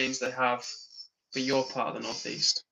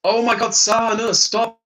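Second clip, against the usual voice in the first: loud high cry of disbelief, much higher and louder; exasperated outcry; 8 semitones higher, 10 dB louder, slower than usual. Real, not acted. Streamer yells at a chatter begging for money, loud and high. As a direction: shout it out in real exasperation.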